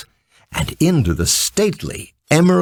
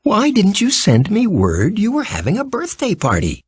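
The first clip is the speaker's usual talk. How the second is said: put-on girl's question; 10 semitones higher than usual. A narrator's reading. Dorothy voiced asking Ozma.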